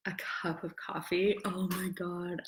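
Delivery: secretive little whisper. Real, not acted. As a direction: whisper it like a guilty treat. Confiding about her coffee.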